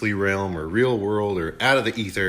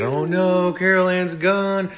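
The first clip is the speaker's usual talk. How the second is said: high, hammed-up wail of loss; mock grief; 9 semitones higher than usual. Family mourning Carol Ann, a high mock lament. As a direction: whine it like the grieving family. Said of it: "And everybody's sad".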